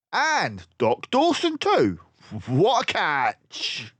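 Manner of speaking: high, loud crook voice; gloating pirate crook. Acted. Crook gloating over unmasked Basil. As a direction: relish the catch.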